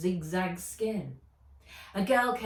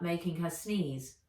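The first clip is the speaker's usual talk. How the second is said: ordinary delivery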